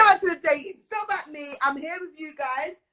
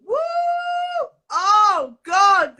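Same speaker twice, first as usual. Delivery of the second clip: louder exclamation; excited shout; 6 semitones higher, 9 dB louder, much slower than usual. Real, not acted. Host cheering as the next guest joins, excited shout. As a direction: let the excitement burst out.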